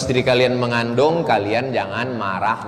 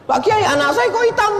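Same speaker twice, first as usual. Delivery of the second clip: complaining parent; 5 dB louder, faster than usual. Retold or imitated.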